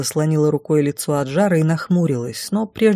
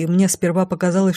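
ordinary delivery, nothing marked

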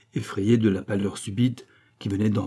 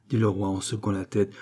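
usual speaking voice

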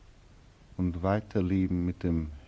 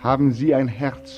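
accusing challenge; 6 semitones higher, 5 dB louder than usual. Acted. Accusing question to Frau Kramer.

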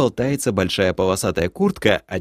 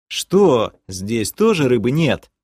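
plain reading voice

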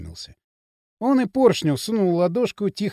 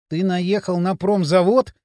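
ordinary delivery

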